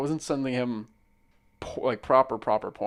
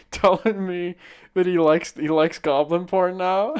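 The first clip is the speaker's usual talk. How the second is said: excited punchline; 6 semitones higher, 6 dB louder than usual. Real, not acted. Punchline of funny story.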